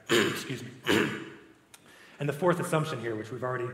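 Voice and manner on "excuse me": raspy, strained voice after throat clearing; losing voice. Real, not acted. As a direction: voice cracking and giving out. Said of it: "I'm losing my voice".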